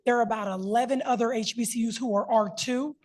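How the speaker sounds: usual speaking voice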